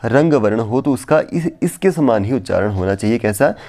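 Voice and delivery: ordinary talk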